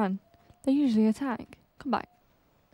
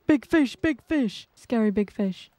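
startled alarm; 3 dB louder than usual. Real, not acted. Player spotting scary big fish, startled alarm. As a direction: yelp it after a sharp gasp.

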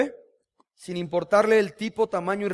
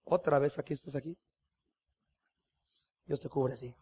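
soft, lower, gentle voice of God; God's tender reply; 3 semitones lower, 7 dB quieter than usual. Retold or imitated. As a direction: reassure tenderly, almost a murmur. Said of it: "Y Dios, ¿qué te dice?". (Dios responde con ternura, en voz baja)

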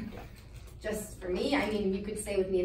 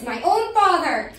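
loud, slow, higher sarcasm; heated, scornful self-accusation; bitter sarcasm; 5 semitones higher, 8 dB louder, slower than usual. Acted. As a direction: draw out the mock outrage.

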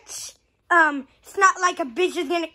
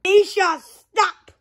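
usual speaking voice

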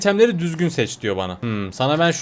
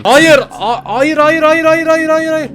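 shrill, much higher panicked repeated no; frantic no; 11 semitones higher, 7 dB louder, slower than usual. Pretend. Player shrieking no as the giant attacks, frantic high cries. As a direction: cry out no in rising panic.

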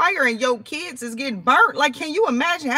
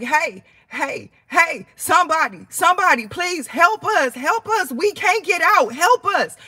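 pleading for help; 5 semitones higher than usual. Retold or imitated. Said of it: "I'm like".

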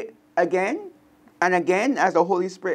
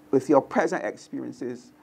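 ordinary delivery

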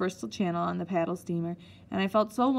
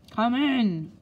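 loud, commanding boom; 4 semitones higher, 6 dB louder than usual. A narrator's reading. Matron answers a knock on her door, booming. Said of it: "boomed the voice".

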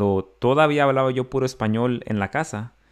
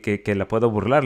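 normal talking voice

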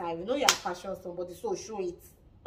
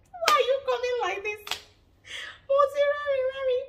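high, loud, laughing disbelief; a loud, high laughing jeer; 8 dB louder than usual. Real, not acted. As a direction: cackle it out in shock. Mocking reaction to an outfit.

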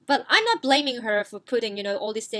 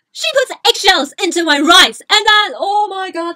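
loud exasperation; 5 semitones higher, 11 dB louder than usual. Real, not acted. Reliving the eggshell horror.

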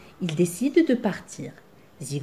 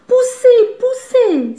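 out of breath; 13 semitones higher, 7 dB louder, slower than usual. A narrator's reading. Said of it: "s'essouffle Zigomar".

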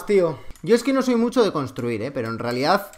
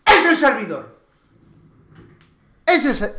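angry shout; 6 semitones higher, 5 dB louder than usual. Real, not acted. Blaming the server.

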